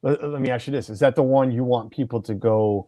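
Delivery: ordinary talk, nothing marked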